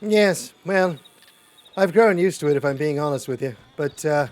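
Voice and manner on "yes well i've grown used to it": loud. Acted. Defends stew-only diet loudly.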